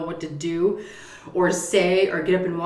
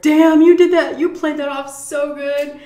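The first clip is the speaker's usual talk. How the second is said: high, loud opening whoop of praise, easing off; impressed cheering; 10 semitones higher, 10 dB louder than usual. Retold or imitated. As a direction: imitate a family hyping up the prankster. Family cheering the prank.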